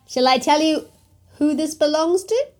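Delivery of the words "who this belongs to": plain reading voice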